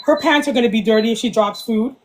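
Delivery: ordinary talk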